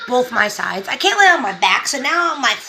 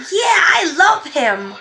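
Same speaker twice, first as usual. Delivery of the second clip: put-on higher-pitched little-kid enthusiasm; imitated toddler; 6 semitones higher, 4 dB louder, slower than usual. Two-year-old meeting his baby brother, imitated eager affection. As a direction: play a two-year-old gushing lovingly. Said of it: "all I kept hearing from him was".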